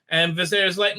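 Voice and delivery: ordinary talk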